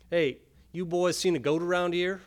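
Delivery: put-on rural farmer accent. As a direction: call out like a country farmer with a thick rural accent. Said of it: "in his accent ripe as rotten pears".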